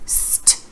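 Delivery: "St" breathed out as two separate sounds, s then t; teacher sounding out "St" without voice; whispered st sound. Shown as a demonstration. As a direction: hiss the s then tap the t. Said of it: "You hear the two sounds".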